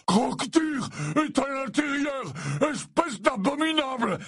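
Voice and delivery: high, anguished shouting; outraged cry. A narrator's reading. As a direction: cry out in horror and accuse. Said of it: "s'écria Hagrid".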